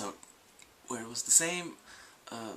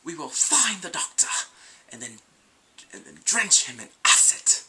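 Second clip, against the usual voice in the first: loud, menacing threat, louder; warrior's threat; 3 semitones higher, 11 dB louder than usual. Retold or imitated. Vlogger voicing the warrior threatening the Doctor, loud menace. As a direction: boom out the threat like a brutal warrior. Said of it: "Drax is like".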